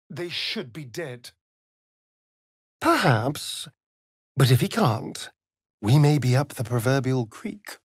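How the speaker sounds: ordinary talk, nothing marked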